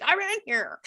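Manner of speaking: high mock scream, held back in volume; imitated scream. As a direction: mimic a scream without the volume, half laughing.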